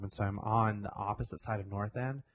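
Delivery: ordinary talk, nothing marked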